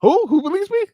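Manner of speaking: high, indignant disbelief; who, me? shocked; incredulous. Real, not acted. Indignant protest at not being believed.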